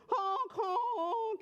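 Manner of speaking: two long high honks; goose honk. A narrator's reading. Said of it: "He honked".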